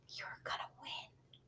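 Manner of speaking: slow, breathy whisper. A narrator's reading. Girl leaning back to tell George he'll win, whisper. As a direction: whisper it secretly close by.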